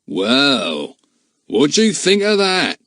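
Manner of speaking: lightly voiced gruff man exclaiming. A narrator's reading. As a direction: call it out as a startled stranger. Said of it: "He gave a loud exclamation".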